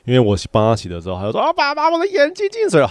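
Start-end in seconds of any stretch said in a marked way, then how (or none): 1.4-2.9 put-on much higher, childish complaining voice; whiny little kid. Son whining to dad at bath time, high childish voice. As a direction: play the small son whining about water in his eyes.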